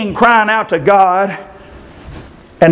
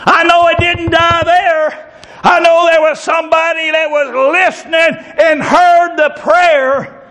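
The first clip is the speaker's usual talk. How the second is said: raised, fervent preacher's voice, slowing on key words; preaching at full force; 11 semitones higher than usual. Acted. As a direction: preach it out to the congregation.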